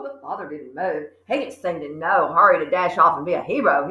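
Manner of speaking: ordinary delivery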